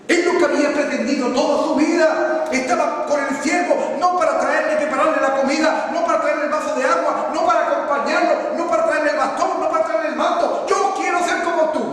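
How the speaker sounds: high, loud, driving sermon cadence; full-force preaching. Acted. Preacher rousing the congregation about Eliseo's devotion, fervent. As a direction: hammer it home to the congregation.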